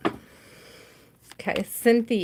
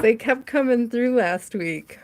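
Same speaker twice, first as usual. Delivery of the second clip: ordinary talk, nothing marked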